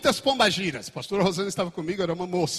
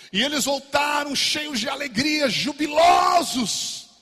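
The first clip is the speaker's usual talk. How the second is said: higher and louder, stretching jubilosos out; joyful shout; 8 semitones higher, 4 dB louder, slower than usual. Acted. Preacher proclaims the disciples' joy.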